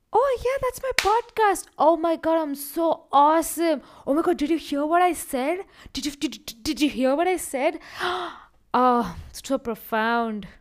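high, loud, hammed-up gloating; mock himself as a bragging show-off; smug self-praise. Podcaster mocking an overly proud reaction to his podcast, hammed-up. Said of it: "Option one. Am I gonna be like".

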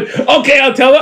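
high-pitched flustered reply; mock panic. Caught prankster replying to mom's caller, mock panic. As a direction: retell it in a squeaky fluster. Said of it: "I fucking panic".

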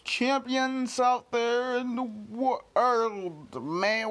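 loud, drawn-out greeting; big announcer-like opening holler; hype intro shout. Real, not acted. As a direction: stretch each word out loudly to the crowd.

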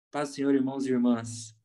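mock the stiff evangelical pulpit voice, lightly; churchy evangelical tone. Pastor mocking a speaker greeting the church in a lightly churchy voice. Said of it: "mudou o tom de voz, fez o evangeliquez".

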